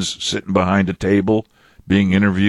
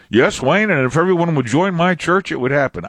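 mock churchgoer voice; 9 semitones higher than usual. Mocking a church member's boast.

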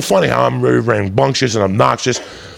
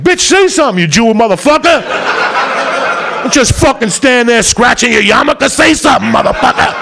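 mock angry yell; 10 semitones higher than usual. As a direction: bark at a useless lawyer, half laughing. Yelling at his attorney in court.

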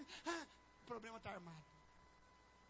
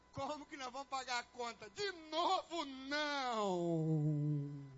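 mock despair; 6 dB louder, much slower than usual. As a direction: wail over the bills, sinking into a long groan. Acted despair over the bill.

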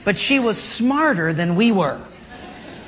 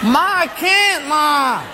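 put-on whining kid; 6 semitones higher, much slower than usual. Son complaining to his mother, high slow whine. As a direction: plead like a boy who can't do it.

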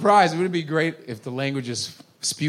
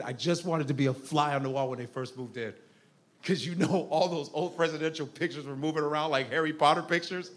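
soft, laughing through the words; 3 semitones lower, 8 dB quieter than usual. Real, not acted. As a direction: giggle through it softly. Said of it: "I always wanted to be".

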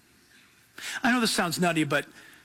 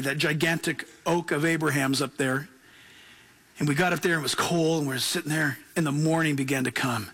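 usual speaking voice

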